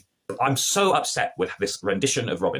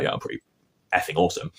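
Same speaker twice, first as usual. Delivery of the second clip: put-on cocky teen; 6 semitones lower than usual. Robin's cocky reply to the guard.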